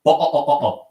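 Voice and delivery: "But, uh, uh" with the t swallowed, high; mock British glottal "but uh". Shown as a demonstration. Streamer mocking British slang, high mock British glottal stops. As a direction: gulp the t's like a Brit. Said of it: "You can literally say".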